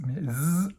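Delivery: "Z" said as a clear buzzing z, not an s; teacher modelling the "Z" buzz; Z sound. Shown as a demonstration. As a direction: sound out "Z" for the class. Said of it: "le S ne se prononcera pas S".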